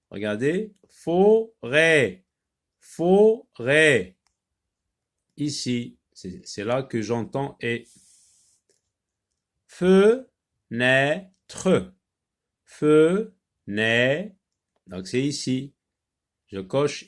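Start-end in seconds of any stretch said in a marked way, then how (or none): 0.9-4.2 "forêt" broken firmly into fo... rêt, higher and louder; split into syllables. Shown as a demonstration. Teacher locating the sound for pupils, split into syllables. 9.7-14.4 "fenêtre" broken into fe... nê... tre, louder; split into syllables. Shown as a demonstration. Teacher locating the sound for pupils, split into syllables.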